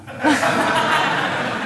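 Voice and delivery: breathy airy laugh at the joke; amused real laughter. Real, not acted. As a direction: let a real laugh slip out. Audience member laughs at joke.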